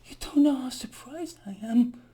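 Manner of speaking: whispered mock surprise; sarcastic whisper. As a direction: breathe it out, dripping with sarcasm. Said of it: "Imagine Greg Mannarino shock".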